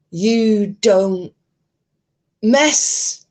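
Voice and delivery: hammed-up tirade with forced gaps; Lady Witch impression. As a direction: punch each word out like a self-righteous YouTuber. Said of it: "uncalled for punctuations in between each word".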